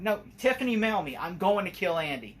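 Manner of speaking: imitating a pissed-off killer doll; angry Chucky. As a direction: fume it out like a furious doll. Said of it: "he's like".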